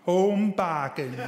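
slow, carefully spelled-out name; deliberate slow enunciation; slowly and clearly. Retold or imitated. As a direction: say it slowly, every syllable clear for the foreigners. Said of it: "pronouncing slowly and clearly".

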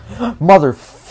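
high, loud startled yell; startled curse. Real, not acted. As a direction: jump and swear at the scare. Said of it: "That scared me".

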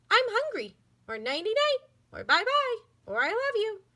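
high, flat toy-doll voice, same tune every line; a pull-string doll reciting its phrases; talking doll voice. Acted. Talking doll on a string says its lines in one high voice. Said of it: "all in the same flat, dull voice".

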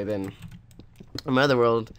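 normal talking voice

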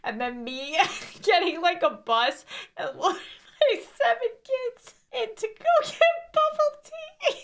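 words dissolving into helpless laughter; losing it mid-sentence; crying laughing. Real, not acted. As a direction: climb into a high, laugh-choked squeal. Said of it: "I was actually crying laughing".

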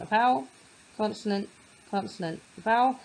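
each label set apart with a pause; teacher labeling letters. Shown as a demonstration. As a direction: mark each letter in turn. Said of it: "mark them on".

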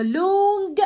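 لونج with its vowel stretched out; drawn-out long sound. Shown as a demonstration. Teacher showing children what a long vowel is, drawn out. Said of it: "صوت مد كده".

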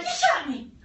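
high, loud mock-outraged yell; hammed-up accusation from the risen victim; accusing shout. Risen Rasputin accuses his killers.

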